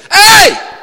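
a sudden high, loud shout. Retold or imitated. Jesus rebuking the storm, sudden loud shout. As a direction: bark it like a command over the storm. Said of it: "he just basically came out and said".

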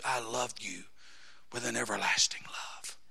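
hushed, soft voice of love; tender whisper. Acted. Preacher voicing Jesus whispering love to the listener. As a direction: whisper it like a loving promise.

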